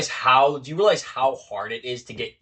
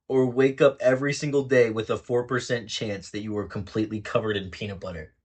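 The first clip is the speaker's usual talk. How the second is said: normal talking voice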